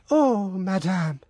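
high, slow worried plea; anxious servant. A narrator's reading. Servant undressing her mistress, high and worried.

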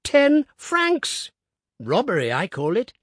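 put-on high, loud indignant old man; old Woodifield outraged at hotel prices; indignant piping. A narrator's reading. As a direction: huff over the price of jam. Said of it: "he piped".

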